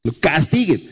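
a lightly voiced stern angry father; harsh father. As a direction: scoff like a fed-up father. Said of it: "algunos papás deben decir".

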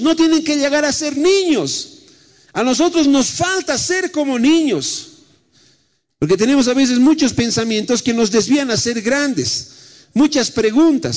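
lively preacher to congregation; preaching. Acted. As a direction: preach with rising fervour. Preacher sermon delivery.

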